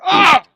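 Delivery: high, loud "ah" of alarm; startled yell. Retold or imitated. Spider-Man jumped by vampire rabbit, high startled yell. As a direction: yell out as if suddenly attacked.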